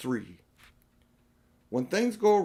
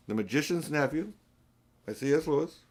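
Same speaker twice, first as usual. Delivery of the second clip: plain reading voice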